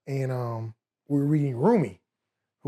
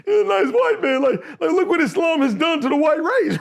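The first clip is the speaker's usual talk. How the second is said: very high, comic marvelling; amazed; 11 semitones higher, 4 dB louder than usual. Retold or imitated. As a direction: marvel at it in a high, amused pitch.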